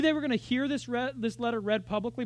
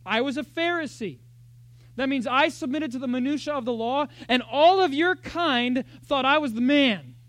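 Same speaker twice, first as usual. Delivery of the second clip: louder, raised challenging voice; Paul taunting; 5 semitones higher, 8 dB louder than usual. Retold or imitated. Paul daring his rivals, loud taunt. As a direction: bring it on, mock-swagger. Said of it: "He says".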